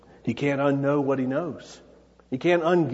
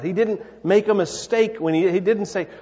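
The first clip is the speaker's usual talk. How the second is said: ordinary delivery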